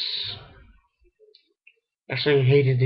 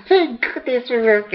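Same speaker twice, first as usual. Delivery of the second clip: relieved cry; 10 semitones higher than usual. Retold or imitated. Acting out character's relieved reaction.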